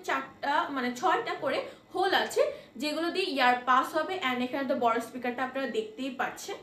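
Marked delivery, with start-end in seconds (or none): none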